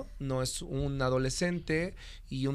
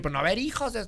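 pitched-up exasperated mock telling-off; exasperated parent about to swear; mock scolding; 7 semitones higher, faster than usual. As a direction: start telling the kids off, voice jumping up.

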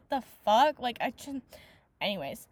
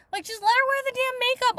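higher-pitched exasperated plea; 11 semitones higher than usual. Retold or imitated. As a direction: vent it in a high, indignant voice. Exasperated rant about characters' behaviour.

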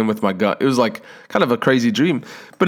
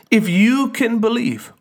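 much higher and slow, leaning on "believe"; emphatic Jesus reply; 10 semitones higher, slower than usual. Retold or imitated. Jesus answering the doubting father, high and slow. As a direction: lift it high and draw it out.

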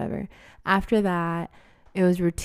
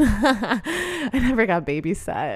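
slow, higher, words shaken by laughter; laughing; 4 semitones higher, slower than usual. Real, not acted. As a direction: say it while cracking up at yourself. Laughing at own joke.

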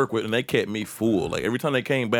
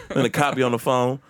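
usual speaking voice

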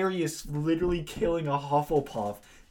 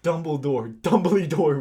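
second call louder than the first; mock yell, getting louder; 3 semitones higher, 8 dB louder than usual. A narrator's reading. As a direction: call out for help, rising in volume. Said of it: "we both yelled".

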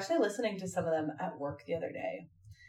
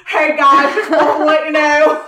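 loud, higher mock caller, laughing; voicemail guy voice; 8 semitones higher, 15 dB louder, slower than usual. Retelling the skit voicemail to friends, laughing. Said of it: "the voicemail guy".

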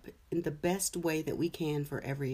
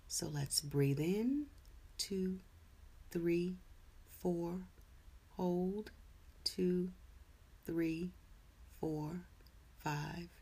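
soft, spaced-out counting; slow breath counting; 8 dB quieter, much slower than usual. Shown as a demonstration. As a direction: count each breath gently. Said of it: "I'll count".

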